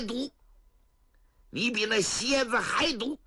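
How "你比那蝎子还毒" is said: ordinary delivery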